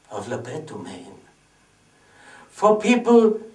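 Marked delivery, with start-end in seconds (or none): 0.1-1.2 very soft, low, fading away; hushed, low theatrical lament; mournful hush. Acted. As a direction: let the name die away sadly. The death of his act.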